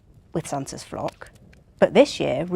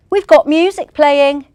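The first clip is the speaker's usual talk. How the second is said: shouting over music; 7 semitones higher, 10 dB louder than usual. Acted.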